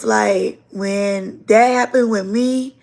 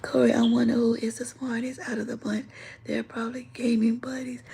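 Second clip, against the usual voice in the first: breathy fast whisper, much softer; 10 dB quieter than usual. Real, not acted. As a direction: whisper the chat comment quickly to yourself. Reading a chat comment aside.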